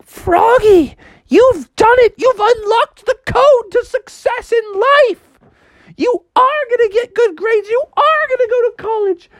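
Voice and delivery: very high, excited mom voice; hammed-up motherly celebration; Froggy's thrilled mom. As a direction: proclaim the triumph, high and emphatic.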